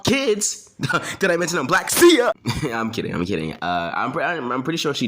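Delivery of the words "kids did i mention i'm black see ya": high, fast comic freak-out building to a shout; hammed-up alarmed exit, much higher and louder; mock panic. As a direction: blurt it and bolt, yelling the goodbye. Mock panicked escape from her kids.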